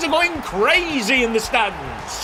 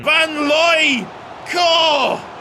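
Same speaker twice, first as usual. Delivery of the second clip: excited shout; 5 semitones higher, much slower than usual. Real, not acted. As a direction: yell the name at the screen. Calling out in game.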